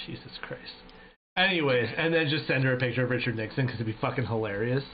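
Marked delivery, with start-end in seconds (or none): 0.1-0.7 breathless whispered "Jesus Christ"; whispered exasperation. Real, not acted. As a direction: whisper it, half laughing in disbelief. Reacting to chat comment.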